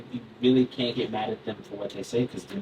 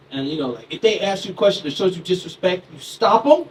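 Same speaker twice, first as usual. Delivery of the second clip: usual speaking voice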